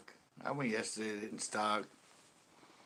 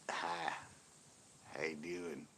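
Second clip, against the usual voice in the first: soft, mock-flirty greeting; mock flirting; 5 semitones lower, 10 dB quieter, slower than usual. Teasing a friend about fancying a sister, mock-flirty. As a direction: tease with a quiet pick-up line. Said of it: "you go up there and be like".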